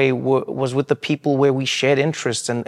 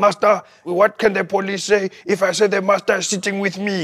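higher, put-on old man pleading; grandfather's voice; 6 semitones higher, much faster than usual. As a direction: imitate grandpa worrying about police. Imitating grandfather's anxious question.

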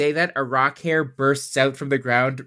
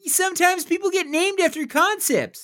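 high squeaky voice. Acted. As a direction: squeak it like a tiny inner voice. Memory voice, high pitched.

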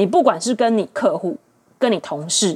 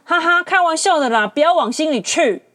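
breezy mock laugh, a touch higher; imitating dismissive date; 6 semitones higher than usual. Her date shrugging off his diss, mock-breezy. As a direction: brush it off lightly, hammed-up. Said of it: "然后他还说".

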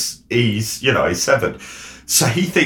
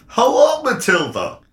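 high, put-on Dutch Miss Trunchbull; comic Dutch-accented villain greeting; 12 semitones higher than usual. Shown as a demonstration. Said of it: "I'd do Miss Trunchbull as Dutch".

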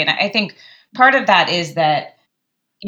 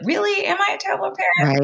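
much higher, fast, incredulous voice; mock disbelief; 14 semitones higher, faster than usual. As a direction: question the worry in a squeaky, doubting tone. Parent talking the thought down.

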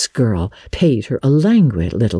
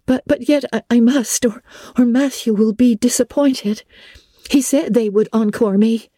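flustered stammer, pitched high; a girl tripping over her words; confused; 10 semitones higher than usual. Acted. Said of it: "said Anne confusedly".

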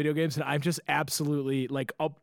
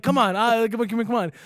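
higher, urgent repeated beckoning; imitated hurrying; 6 semitones higher, 4 dB louder than usual. Driver hurrying confused travelers along, imitated urgent beckoning. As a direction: rattle it off as if herding people along.

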